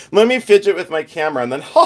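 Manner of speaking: fast, with put-on giggles breaking in; fake-laugh your way through the line. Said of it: "I'm gonna laugh sporadically".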